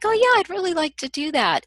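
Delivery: limp, unconvincing yes; wishy-washy. Shown as a demonstration. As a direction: say it like you don't really mean it. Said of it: "it sounds like that".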